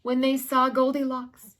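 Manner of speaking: plain reading voice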